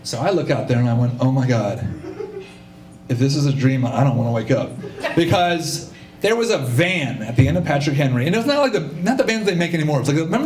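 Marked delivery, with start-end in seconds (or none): 1.2-4.7 low, awestruck wonder; low mock-dramatic amazement; mock awe. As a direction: play it reverent and low, as if dreaming. Awestruck at the perfect target.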